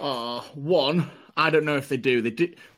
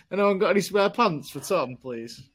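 higher pleading mock appeal; mock plea; 5 semitones higher than usual. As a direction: beg sweetly, hamming it up. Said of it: "put another plea out".

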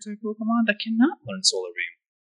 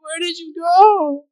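mock sobbing; 7 semitones higher, slower than usual. Prince mourns lost lady in skit.

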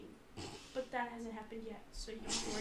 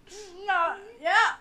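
strained laughing groan; 8 semitones higher, 12 dB louder, slower than usual. Real, not acted.

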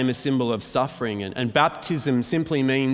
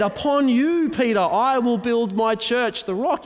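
Jesus proclaiming; 11 semitones higher, 4 dB louder than usual. Retold or imitated. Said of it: "Jesus said".

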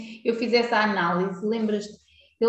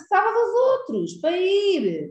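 high-pitched mocking repetition; mock her nagging, pitched up; high mocking repeat; 6 semitones higher than usual. Mocking a friend's scolding.

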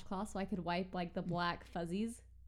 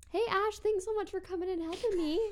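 cheerful thanks; 11 semitones higher, 4 dB louder than usual. Retold or imitated. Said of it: "was like".

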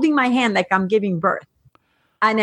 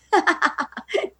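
amused laugh; 8 semitones higher than usual. Real, not acted. Laughs at his joke.

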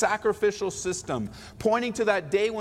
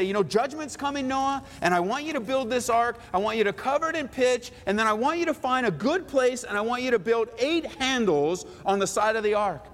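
lightly raised, hammed-up divine instructions; mock voice of God; 7 semitones higher than usual. Preacher mocking God's instructions to Noah, lightly raised voice. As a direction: play God giving silly orders, a bit higher. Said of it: "God doesn't come to Noah and say".

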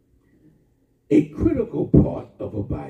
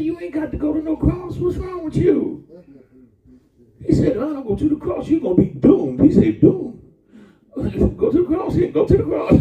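Peter arguing; 12 semitones higher, faster than usual. Acted. Peter arguing, then laughing.

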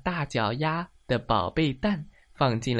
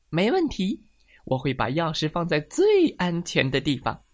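higher, eager hen voice; confident hen character; 5 semitones higher, 4 dB louder than usual. Acted. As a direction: promise it brightly as a confident hen. Hen cheerfully accepts the job.

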